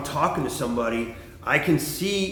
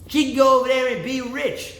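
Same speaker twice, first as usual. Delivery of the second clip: high, louder mock of the guy; 11 semitones higher, 5 dB louder than usual. Mocking the guy's sulky reply, high and loud. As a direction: whine it like a sore loser. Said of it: "and I'm like".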